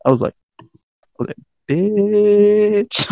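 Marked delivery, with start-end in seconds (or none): 1.7-3.1 high, long-drawn mock outcry; hammed-up retold outburst; exasperated cry. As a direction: stretch the one word out high. Retold reaction to the cashier.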